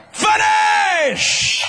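long, very high held shout; cried out. Acted. Preacher voicing Jesus answering the Father, crying out. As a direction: cry it out with all the breath, stretched. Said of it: "Then Jesus cried".

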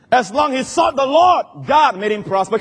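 ringing pulpit shout; full-force preaching. Real, not acted.

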